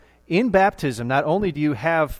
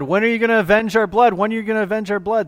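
high, fast pleading cry; 9 semitones higher, 7 dB louder, faster than usual. Retold or imitated. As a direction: cry out to God for vengeance. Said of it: "they're crying out and asking God".

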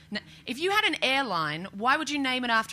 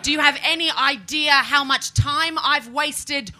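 loud, slow, higher indignant rant; hammed-up rant; 7 semitones higher, 6 dB louder, slower than usual. Child ranting at mum, slow loud indignation. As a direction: drag out the grievance loudly.

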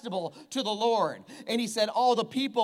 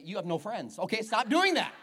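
ordinary talk

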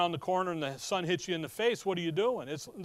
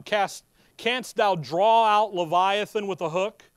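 slow, louder King James recitation; solemn scripture reading; 5 dB louder than usual. Acted. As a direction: proclaim the verses with weight. Reads King James scripture aloud.

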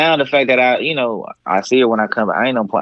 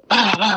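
whooping noises; 8 semitones higher than usual. Retold or imitated. Imitated whooping sounds.

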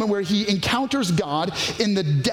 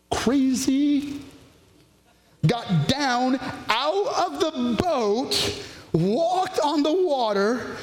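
drawn out, a little higher, savoring each step; slow it right down like a miracle unfolding; slow dramatic telling; 4 semitones higher, much slower than usual. A narrator's reading. Preacher dramatizing Peter stepping onto the water, slow.

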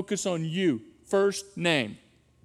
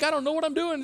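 mock judging teacher; 7 semitones higher, faster than usual. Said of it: "they're gonna think".

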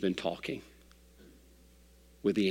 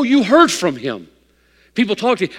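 ordinary talk

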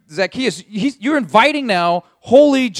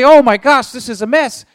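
loud dismay; 5 semitones higher, 5 dB louder than usual. Acted. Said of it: "And then you say".

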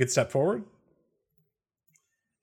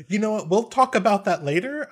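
much higher-pitched, brushing off; placating; 9 semitones higher, 4 dB louder than usual. Acted. Pushing Ruse out of the circle, placating. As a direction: gently shoo them off in a high voice.

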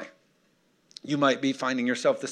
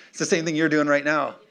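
ordinary delivery

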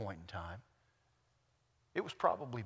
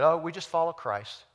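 higher, louder group retort; mock partisan; 6 semitones higher, 11 dB louder than usual. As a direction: pipe up as the rival faction. Voices a rival church group.

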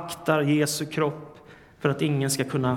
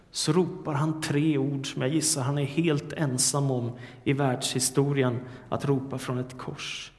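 ordinary talk, nothing marked

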